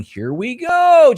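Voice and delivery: slow, high-pitched Peter Pan cry; Peter Pan impression. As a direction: sing it out high like Peter Pan launching the ride. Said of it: "you hear Peter Pan saying".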